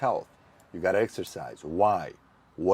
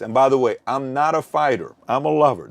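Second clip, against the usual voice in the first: playful boast; 5 semitones higher, 9 dB louder than usual. Real, not acted. Playful boast, loud and high.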